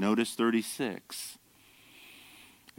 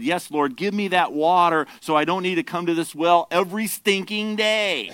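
higher and louder, fed up with the daily trip; a put-upon woman who wants the chore gone; mock weary complaint climbing higher; exasperated woman at the well; 7 semitones higher, 7 dB louder than usual. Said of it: "She said".

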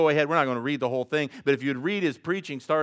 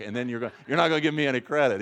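normal talking voice

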